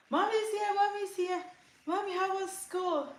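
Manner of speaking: put-on very high-pitched child's voice; excited kids. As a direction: greet mom like little kids running in. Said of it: "my kids will come running downstairs".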